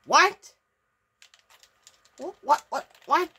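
3 jolted by the frozen screen; 1 startled what. Real, not acted. 4 gamer to viewers as the game freezes, startled.

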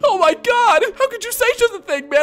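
very high-pitched whiny wail; hammed-up squealing distress; mock hurt and sensitive. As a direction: overreact like a wounded crybaby. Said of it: "I'm so sensitive".

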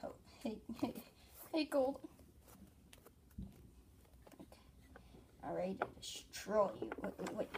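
plain reading voice

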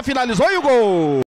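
rising shout of goal; goal call. Real, not acted. Goal call in futsal commentary.

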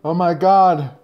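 panicked. Acted. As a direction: panic at being alone. Character panics alone.

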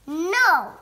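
loud, high, drawn-out NO; angry refusal. A narrator's reading. Iguana stops her lazy friends, angry. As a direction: cut them off with an outburst.

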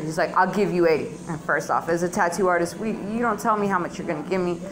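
usual speaking voice

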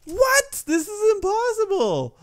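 very high-pitched outraged squeal; shrill disbelief. Real, not acted. Failing a hard game level.

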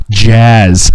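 a sudden loud yell of Jazz. Retold or imitated. Brother yelling jazz into [name]'s ear, loud shout. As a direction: shout the word right into his ear. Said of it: "he yells in my other ear".

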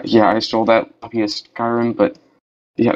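ordinary talk, nothing marked

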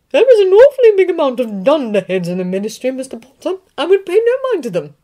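put-on loud, high, tart old witch professor; McGonagall. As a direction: scoff at the fools in the Ministry. McGonagall dismisses Ministry dunderheads.

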